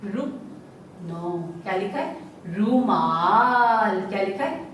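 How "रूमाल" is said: रूमाल with the ऊ drawn out long and slow. Shown as a demonstration. Teacher to class, stretching रूमाल slowly. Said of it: "जल्दी से बोला जा रहा है".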